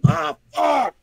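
high, loud frustrated cursing; frustrated fan venting loudly; worked-up outburst. Real, not acted. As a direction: let out an exasperated, high-pitched yell. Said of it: "Oh, calm down".

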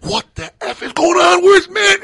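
high, loud mock-furious demand; yelling. Boss hunting the missing employee, yelling in a retold prank. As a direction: bellow it like a boss losing patience. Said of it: "I'm yelling".

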